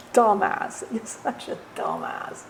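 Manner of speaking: slow, breathy taunting whisper; the shadow taunts him in a slow whisper; mocking whisper. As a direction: sneer it quietly like a mocking shadow.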